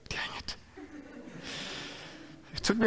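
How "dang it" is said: soft defeated whisper; whispered dang it. Retold or imitated. Caught out by the Holy Spirit, whispering in defeat. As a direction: breathe it out under your breath, caught out. I was like.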